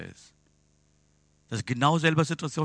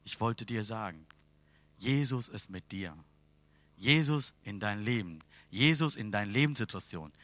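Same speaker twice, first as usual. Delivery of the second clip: soft closing appeal; 2 semitones lower, 6 dB quieter than usual. Real, not acted. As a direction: draw them in gently to pray. Quiet closing appeal and prayer.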